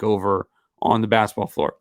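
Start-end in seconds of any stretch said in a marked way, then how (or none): 1.5-1.7 the voice gives out on the last word; a real break as the voice goes; voice cracking. Real, not acted. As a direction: let the voice crack and slip away. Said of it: "my voice is kind of escaping there".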